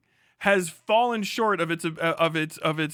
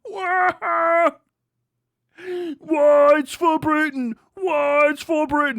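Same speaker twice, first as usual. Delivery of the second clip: high, loud crying wail; mock wailing Brits; 10 semitones higher, 7 dB louder, slower than usual. Mocking British protesters, high loud fake crying wail. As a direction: wail it like a hammed-up crying crowd. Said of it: "people just like".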